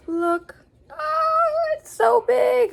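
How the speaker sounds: high squealing delight; excited squeal. Real, not acted. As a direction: squeal over the big book. Excited over large print book.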